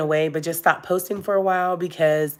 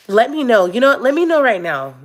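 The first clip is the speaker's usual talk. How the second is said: higher and louder, pressing the viewers; eager call-out; 6 semitones higher, 5 dB louder than usual. Real, not acted. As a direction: call out to the chat for an answer now. Urgently asking viewers for input.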